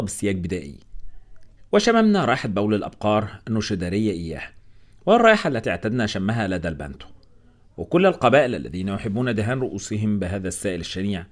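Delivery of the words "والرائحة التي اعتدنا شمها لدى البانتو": ordinary delivery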